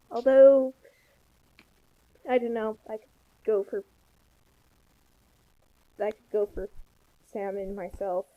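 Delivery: ordinary delivery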